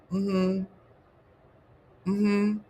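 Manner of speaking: usual speaking voice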